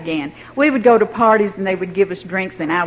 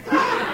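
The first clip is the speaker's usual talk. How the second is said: soft laugh; 8 dB quieter than usual. Real, not acted. Laughing at memory.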